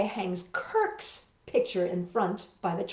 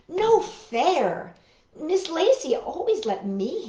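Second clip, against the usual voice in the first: complaint; 7 semitones higher, 4 dB louder than usual. Acted. Child complaining.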